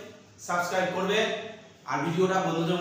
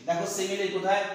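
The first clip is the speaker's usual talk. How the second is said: ordinary delivery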